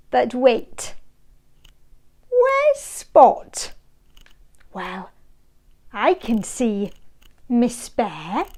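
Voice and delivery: ordinary delivery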